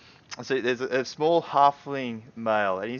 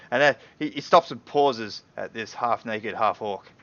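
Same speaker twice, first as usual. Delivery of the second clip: ordinary talk, nothing marked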